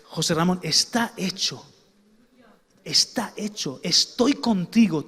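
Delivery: quiet, tender promise of help; gentle reassuring God. Acted. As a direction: speak softly and slowly, like God comforting a weary man. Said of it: "le digo yo".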